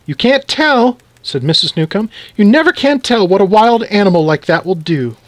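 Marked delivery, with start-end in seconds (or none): 0.0-1.0 high-pitched, agitated retort; alarmed wife. Acted. Alarmed wife argues with husband. 2.4-5.2 high-pitched, agitated retort; alarmed wife. Acted. Alarmed wife warns of mouse.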